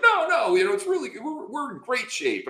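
high-pitched unconvincing reassurance; mock reassurance. His lawyer calming a client sure they'll lose, overeager reassurance. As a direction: imitate a lawyer insisting too eagerly.

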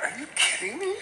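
high, laughing "are you kidding me"; squeaky laughing disbelief in her head; inner disbelief. Retold or imitated. Coach hearing a client's affair, laughing incredulous squeak. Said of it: "in your head you're like".

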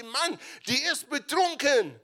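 high, loud mock priest's scoff; scornful priest. Priest Eli scoffing that the woman is drunk, high and loud.

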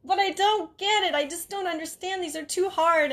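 put-on high, complaining old mother; high whiny protest; mom whining. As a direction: whine that it is too hard. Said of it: "she'd be like".

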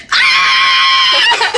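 high laughing shriek; kid scream. Retold or imitated. Retelling the snake dream, a high laughing mock shriek. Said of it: "you're just like".

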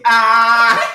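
long high loud scream with laughter; delighted shriek. Real, not acted. Co-host reacting to celebrity gossip, loud laughing shriek.

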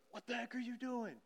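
high, put-on startled voice; startled mock protest. Someone walked in on in secret, startled. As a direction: act caught out, pitch jumping up. Caught out by someone opening door.

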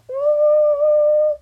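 long, high, loud "ooh"; teasing "ooh". Real, not acted. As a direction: hold a high, loud drama-alert ooh. Reacting to someone wants you.

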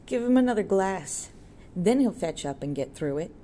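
loud call for another glass. A narrator's reading. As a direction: call across the bar for drink. Tinker Tailor calls for drink.

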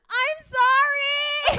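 loud high drawn-out cry; shouted apology. Retold or imitated. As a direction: yell it out helplessly. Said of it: "you're just kinda like".

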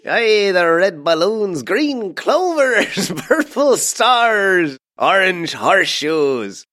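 high, slow Irish leprechaun voice; lilting mascot brogue, laughing. Pretend. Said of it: "leprechaun impression".

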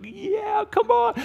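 very high gleeful call; playful Jesus. Acted. Jesus calling children to tackle him, gleeful and high. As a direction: egg the children on, delighted.

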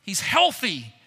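ordinary talk

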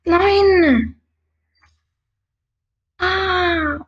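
high, loud panicked shout; scared cry. Real, not acted. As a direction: cry out in real fright. Startled by enemy moving.

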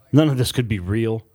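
plain reading voice

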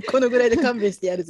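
loud, brisk mock swagger; mock tough sign-off. Host ending the show with a joke threat, laughing mock swagger. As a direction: let them off like a jokey tough guy.

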